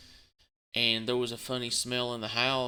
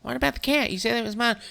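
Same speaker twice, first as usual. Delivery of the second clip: high, louder whine; mock her complaining; whiny ex-wife; 10 semitones higher, 5 dB louder, faster than usual. Ex-wife asking about the cat, high whine. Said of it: "divorce argument retold".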